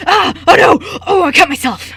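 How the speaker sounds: hammed-up pained yelp, high and loud; loud mock ouch; fake injury cry. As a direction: overact a paper cut like a disaster. Player voices fake injury cry.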